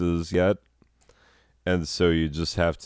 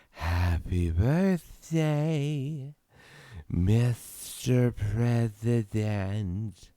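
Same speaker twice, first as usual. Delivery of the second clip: very slow, higher breathy purr; breathy sultry voice; 6 semitones higher, much slower than usual. Shown as a demonstration. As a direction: stretch every word on a breath. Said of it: "vocal posture than".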